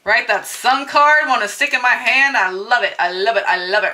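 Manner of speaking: ordinary delivery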